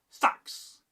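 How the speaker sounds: "Sax" short, just the blade; tight, unstretched. Shown as a demonstration. Teacher demonstrating continental Saxon word, tight short "Sax". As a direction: snap out "Sax" without stretching. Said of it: "No stretching".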